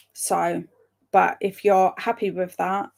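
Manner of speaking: normal talking voice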